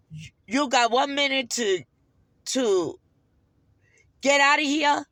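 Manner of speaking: imitating a man throwing someone out; angry ordering out. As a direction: bellow the order as the angry attendant. Acts out attendant ordering her out.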